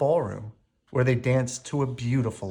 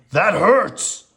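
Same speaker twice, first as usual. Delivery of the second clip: loud pained roar; 7 semitones higher, 9 dB louder than usual. A narrator's reading. Beast roaring at Belle over his arm. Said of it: "he roared".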